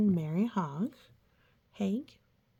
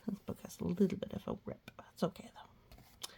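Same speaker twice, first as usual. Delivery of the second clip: whispered aside; 10 dB quieter, faster than usual. Real, not acted. Aside about torn page.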